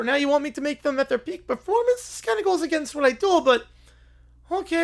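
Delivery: ordinary delivery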